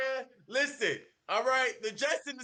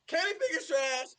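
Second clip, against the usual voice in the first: ordinary talk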